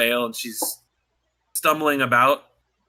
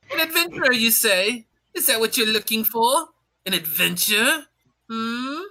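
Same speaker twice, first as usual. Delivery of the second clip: teasing captain; 5 semitones higher than usual. Acted. Captain teasing the eager recruit, sly and high. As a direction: toy with her like a sly captain.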